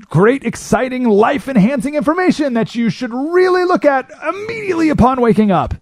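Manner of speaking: high, sing-song mock enthusiasm; mock hype. Mocking the thrill of news in a high, bright mock voice. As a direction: send up the breathless news pitch.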